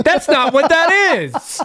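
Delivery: high, loud squeal of laughter; laughing protest. Real, not acted. Co-host objecting to the silly bit, cracking up. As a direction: object through helpless laughter, drawn out.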